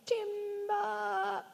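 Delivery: high yelled timber; lumberjack's high warning yell; calling out. Acted. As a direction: call it out like a woodcutter. Lumberjack yelling out.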